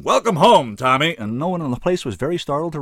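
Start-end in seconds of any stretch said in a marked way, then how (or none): 0.0-1.2 big booming roar, much higher and louder; roaring welcome. A narrator's reading. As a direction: bellow it across the bar in delight.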